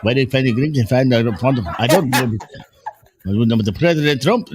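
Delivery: put-on Indian accent; comic impression with an Indian accent. Joking as the Indian dude from the FBI, Indian accent. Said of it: "When I do my Indian accent".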